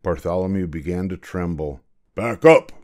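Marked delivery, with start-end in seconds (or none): 2.1-2.7 loud, abrupt order; royal command. A narrator's reading. King orders his coachman, a loud command. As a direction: fire it out as the king. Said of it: "the king commanded".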